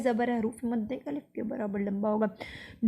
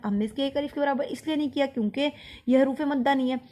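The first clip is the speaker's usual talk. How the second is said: ordinary talk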